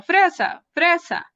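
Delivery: put-on child repeating fresa in echolalia; child echoing. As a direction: chant it back like a child parroting. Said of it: "y el niño repite".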